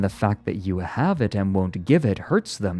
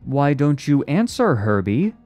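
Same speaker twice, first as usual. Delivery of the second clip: raised, pressing question; triumphant; 7 semitones higher, 5 dB louder than usual. A narrator's reading. Psychologist pushes the silent robot, triumphant. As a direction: press the point, mildly triumphant. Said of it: "there was triumph in the psychologist's voice".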